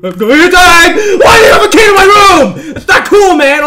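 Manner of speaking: high, loud shrieked protest; panicked screaming. Real, not acted. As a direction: scream in outrage at the intruder. Killer unlocks room, player panics.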